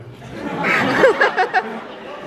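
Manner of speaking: real laughter at own story; cracking up hard; bursting into airy giggles; high breathy laugh. Real, not acted. Teller cracks up.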